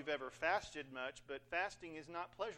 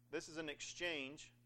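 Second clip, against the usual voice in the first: ordinary talk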